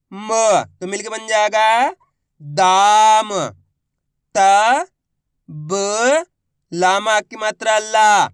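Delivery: slow sounding-out for repeating along; stretched syllables blended into whole words; syllable-by-syllable demo. Shown as a demonstration. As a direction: hold each final syllable long, pausing between sounds. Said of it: "मेरे साथ साथ इनको repeat करना है".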